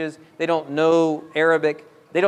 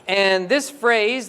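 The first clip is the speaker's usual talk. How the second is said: ordinary talk, nothing marked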